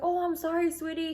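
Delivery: high, sugary put-on voice of the officer; sweet-talking officer. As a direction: play the officer turning suddenly sweet and apologetic. Border officer softens toward her.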